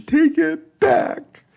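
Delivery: very high, loud, slow tearful command; tearful demand. Acted. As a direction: order him through sobs. Crying niece demands retraction.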